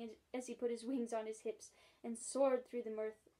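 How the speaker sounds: usual speaking voice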